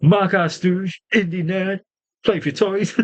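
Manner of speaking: mock-irate quoting, high and gleeful; gleeful quoted insult. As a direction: savour every insult like a fan replaying it. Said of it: "This was great".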